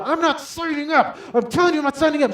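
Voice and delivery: very high, loud yelled refusal; hammed-up shrieking defiance; macho husband refusing. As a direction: yell it over your wife. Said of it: "trying to be this macho husband".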